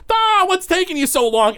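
very high-pitched impatient cry; high comic character yell; Gorbo Borgo. Acted. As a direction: call out in a squawky, exasperated ghost voice. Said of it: "Gorbo Borgo is there, and he goes".

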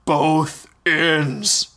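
slow, dim booming giant; a simple giant repeating the words slowly. Acted. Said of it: "repeated in his booming voice".

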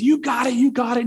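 high-pitched imitated dad cheer; insistent, rallying repeat; egging him on. Dad about to throw his kid, imitated high encouragement. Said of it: "dad just keeps on egging this kid on".

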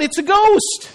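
high, hammed-up cry of alarm; mock terrified cry. A narrator's reading. Disciples seeing Jesus on the water, crying out. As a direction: cry out in storyteller's fright. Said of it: "they cried out".

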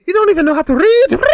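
very high, shrill Irish leprechaun; Conor McGregor. Acted. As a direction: taunt him as a manic little Irishman. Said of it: "leprechaun on crack".

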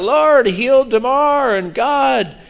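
high, slow pleading cry; crying out in prayer. Retold or imitated. Preacher voicing fans praying for Damar, high slow cry. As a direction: lift it up to God, high and slow. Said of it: "They're crying out".

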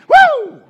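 very high, loud woo; mock church whoop; joyful whoop. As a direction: let out a revival whoop. Imitating the woman's shout.

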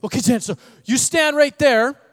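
put-on a small boy bossing his uncle around; excited kid. Nephew tells uncle where to stand at the pool, eager kid voice. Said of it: "He goes".

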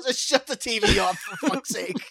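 high mock exasperation; exasperated dad. Friend voices a dad giving up on his soccer game, high exasperation. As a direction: groan it like a fed-up soccer dad. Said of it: "He's like".

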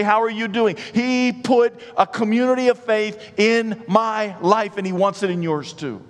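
high, loud preaching; preaching at full force. Acted. Pastor testifying to the congregation at full force. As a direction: preach it with everything you have.

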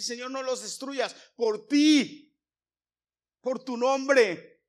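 loud, slow, desperate plea; pleading prayer. Acted. Moses begging God to spare the people, slow loud plea. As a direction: drag out each word as a begging cry.

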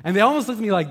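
usual speaking voice

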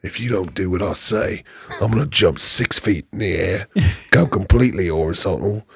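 low, soft British tough-guy threat; quiet action-star menace, London accent; Jason Statham. Acted. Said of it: "That's a really good Jason Statham".

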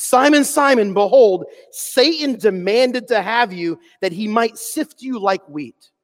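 slow, solemn scripture voice; preaching scripture. Acted. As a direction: call Simon, then slow warning. Jesus speaking to Peter.

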